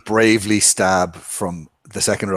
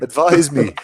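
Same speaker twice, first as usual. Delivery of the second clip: raised, louder playful appeal; mock plea; 5 semitones higher, 4 dB louder than usual. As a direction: beg a comrade with mock urgency. Acted plea to fellow character.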